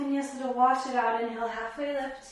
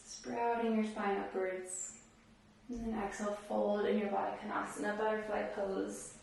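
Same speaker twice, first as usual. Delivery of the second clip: guided meditation; 9 dB quieter than usual. Real, not acted. Yoga teacher settling students after camel pose, softly calming. Leads a seated calming rest.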